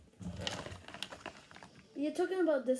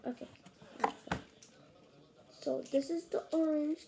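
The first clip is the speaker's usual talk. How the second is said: normal talking voice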